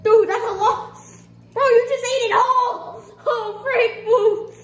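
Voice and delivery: high, loud laughing outburst. Real, not acted. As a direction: crack up in disbelief. Friend ate whole candy.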